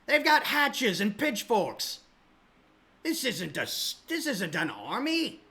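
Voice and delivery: furious, disbelieving captain yelling at a recruiter; gruff captain losing his temper; angry captain. Acted. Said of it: "he says".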